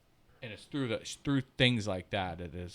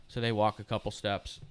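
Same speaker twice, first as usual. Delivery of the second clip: usual speaking voice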